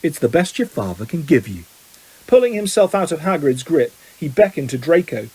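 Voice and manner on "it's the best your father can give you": low, menacing contempt; malicious. A narrator's reading. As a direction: hand over the insult in a low voice. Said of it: "his eyes glittering with malice".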